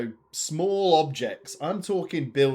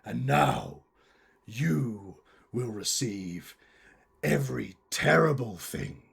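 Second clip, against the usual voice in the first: theatrical villain monologue with deliberate pauses; bitter villainous curmudgeon. Acted. Curmudgeon explains the plot against Christmas, menacing monologue. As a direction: spit out the hatred, then recount it ominously. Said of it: "I am the Christmas curmudgeon".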